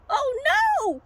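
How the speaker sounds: very high comic wail of panic; freaking out. Acted. Sheep lost in the dark, high mock-panicked wail. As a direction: squeal it as a hammed-up frightened sheep. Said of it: "the sheep be all freaking out".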